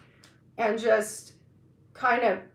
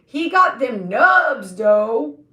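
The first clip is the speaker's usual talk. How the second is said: louder, slower, hammed-up repeat; louder mock-admiring take; 8 dB louder, slower than usual. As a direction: lean on it harder this time. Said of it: "But I was like".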